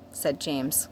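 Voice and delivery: ordinary delivery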